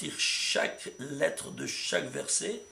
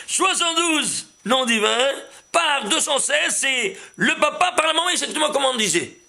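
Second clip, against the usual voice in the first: excited; 9 semitones higher, 7 dB louder than usual. Real, not acted. Teacher showing students his proof, excited, high and loud. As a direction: lay out the proof with rising delight.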